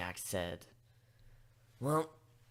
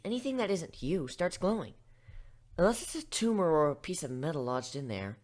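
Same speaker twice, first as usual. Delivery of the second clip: ordinary delivery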